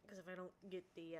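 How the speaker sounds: normal talking voice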